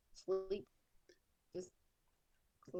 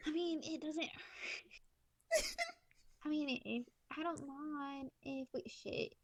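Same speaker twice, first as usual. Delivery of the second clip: laughing; 6 semitones higher than usual. Real, not acted. Player cracking up mid-line.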